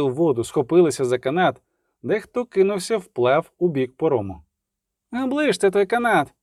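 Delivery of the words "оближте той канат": high, urgent call; shouted protest. A narrator's reading. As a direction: cry out to stop them. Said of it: "крикнув Любисток".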